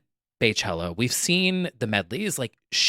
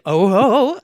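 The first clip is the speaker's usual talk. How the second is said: imitating [name]; 10 semitones higher, 7 dB louder than usual. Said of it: "And she's like".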